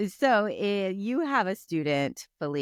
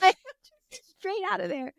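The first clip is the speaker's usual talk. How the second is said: laughing; 12 semitones higher than usual. Real, not acted. Laughing at the memory.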